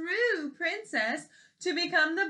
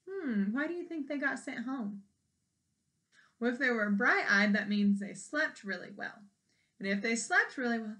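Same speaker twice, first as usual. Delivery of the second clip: ordinary delivery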